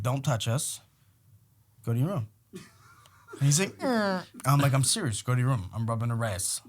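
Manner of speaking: low, soft, stern; firm quiet order. Retold or imitated.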